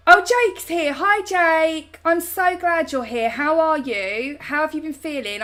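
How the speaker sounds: high, excited welcome; delighted greeting. Real, not acted. Streamer spotting Jake joining the chat, delighted greeting.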